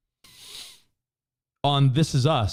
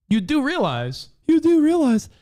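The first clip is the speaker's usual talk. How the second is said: building emphasis; 12 semitones higher, 4 dB louder than usual. Real, not acted. Repeating point, building emphasis.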